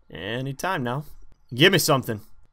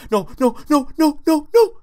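panicked; 7 dB louder than usual. Real, not acted. Panicked cry in game.

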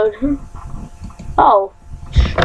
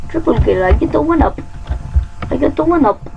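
ordinary talk, nothing marked

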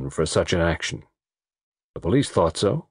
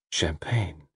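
soft low murmur; undertone; 5 semitones lower, 8 dB quieter than usual. Acted. Waiter murmuring to guests during the song, undertone. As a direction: offer it quietly without disturbing. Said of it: "in an undertone".

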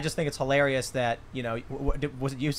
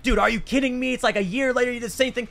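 higher-pitched, retold indignation; mock exasperation; 8 semitones higher than usual. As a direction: replay the annoyed complaint at a higher pitch. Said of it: "now I'm really mad".

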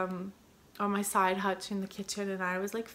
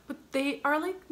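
ordinary delivery

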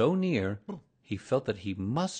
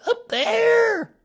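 very high, loud squeal; shrill alarm, last word stretched; frightened Piglet; 11 dB louder than usual. A narrator's reading. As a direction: cry it out as a panicked tiny animal. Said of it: "said Piglet, waving his paw".

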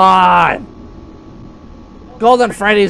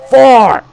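Four, falling and louder; a sharp Four; louder correction; 6 semitones higher, 7 dB louder than usual. Shown as a demonstration. Arguing over the name, a louder Four. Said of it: "it's Five Nights at Freddy's Four".

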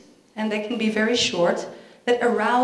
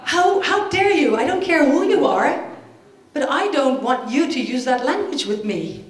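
appalled waitress; 4 semitones higher than usual. Acted. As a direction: play an outraged waitress telling off a customer. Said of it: "And the waitress is appalled".

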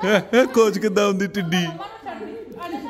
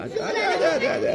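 much higher, softer come-here call; 10 semitones higher, 6 dB quieter than usual. Real, not acted. Calling baby to come.

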